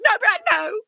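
hammed-up warning to her husband; mock desperate plea. Sister imitating her plea to husband, mock desperate. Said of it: "she goes".

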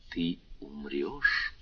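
low, slow, hushed "Ты умрёшь"; a heartbeat telling every creature it will die, hushed and low; ominous inner voice. A narrator's reading. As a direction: breathe it like death whispering. Heartbeat voice of death.

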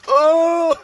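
high loud shriek then laugh; surprised shriek. Real, not acted.

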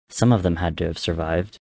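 plain reading voice

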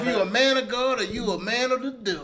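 high, booming challenge; loud accusation. Real, not acted. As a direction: call him out at full volume. Accusing friend in banter argument.

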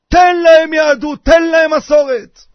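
loud, high, slow preacher's plea; impassioned exhortation. Real, not acted. Preacher urging grandfathers to pass on tradition, loud slow exhortation. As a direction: drive each phrase home at full voice.

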